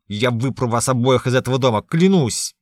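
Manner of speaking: very loud, cursing bark; barked threat. A narrator's reading. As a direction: bark it like a curse. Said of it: "рявкает Ян". (рявкает, будто проклинает)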